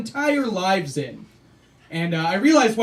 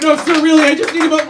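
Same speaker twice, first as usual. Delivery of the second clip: higher and louder, pleading and rushed; flustered canvasser; 7 semitones higher, 9 dB louder than usual. Retold or imitated. Canvasser pleading with the man walking away, higher and louder. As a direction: chase after him, desperate. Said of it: "Be like".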